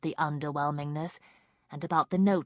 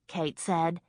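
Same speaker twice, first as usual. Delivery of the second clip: ordinary delivery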